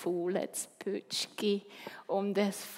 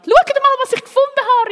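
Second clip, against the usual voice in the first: put-on high, loud mouse-child cry; excited calling out; 18 dB louder than usual. A narrator's reading. Freddy calls classmates to see his find, excited shout. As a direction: shout it across a noisy classroom. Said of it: "rief der Freddy Feldmaus".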